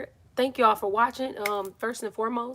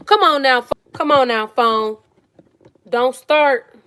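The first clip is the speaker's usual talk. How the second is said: scolding the phone; 3 semitones higher, 13 dB louder, slower than usual. Real, not acted. Scolds her phone as it acts up.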